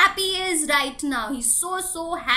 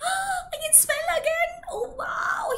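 high, delighted marvelling; happy wonder; 13 semitones higher than usual. Acted. Elephant smells flowers after his blocked nose clears, delighted. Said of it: "He was very happy".

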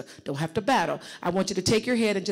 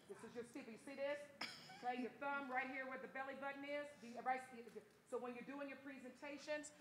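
much softer, hushed explanation; near-whisper demo; 5 semitones higher, 21 dB quieter than usual. Shown as a demonstration. Coach demonstrating the steeple gesture, hushed. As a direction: show it close and quietly.

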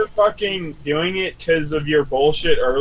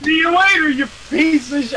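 taunting; 12 semitones higher, 3 dB louder than usual. Real, not acted. Taunting a fleeing enemy.

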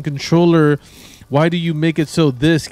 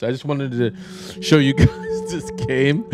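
words broken up by real laughter; slow down as the giggles take over; trying to talk through a laugh; slower than usual. Real, not acted. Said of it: "you know why I'm laughing".

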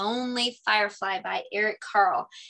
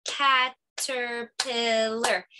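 "Caterpillar" very slowly, each syllable clapped apart; much slower than usual. Shown as a demonstration. Teacher clapping syllables for the kids, very slow. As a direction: stretch "Caterpillar" into four clapped beats. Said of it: "So I'll demonstrate".

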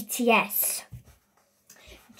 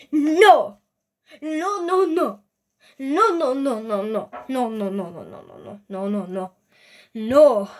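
frantic no; 5 dB louder than usual. Real, not acted. Panicking at the wrong video.

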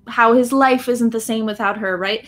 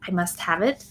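a hint of Gollum, softer; lightly voiced obsessive creature; mock Gollum; 4 semitones lower, 6 dB quieter than usual. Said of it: "we jokingly use this phrase and the voice".

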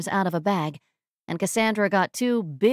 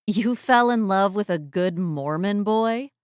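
disbelieving question, rising at the end; incredulous. Acted. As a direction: echo it back in disbelief. Disbelieving echo of his statement.

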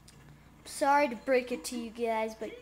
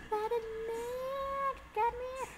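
pleading call; 10 semitones higher, 5 dB quieter, slower than usual. Real, not acted. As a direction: call out softly in a squeaky voice. Calling after the game character.

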